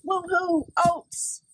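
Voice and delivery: hammed-up cheer for oats; mock woohoo. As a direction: fake delight at the oats. Dog mocks the oats treat.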